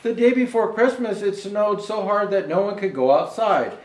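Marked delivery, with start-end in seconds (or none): none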